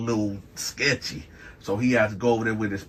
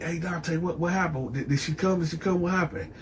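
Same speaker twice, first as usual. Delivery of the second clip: eager questions; 6 semitones higher, 6 dB quieter than usual. Retold or imitated. Eager friend waiting at door.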